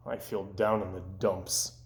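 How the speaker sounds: low, soft and down; glum. Acted. Toad telling Frog how down he feels, low and soft. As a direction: sink into the gloom.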